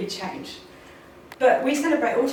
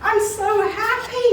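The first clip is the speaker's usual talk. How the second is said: put-on excited child; 8 semitones higher, 3 dB louder, slower than usual. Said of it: "he ran in the room".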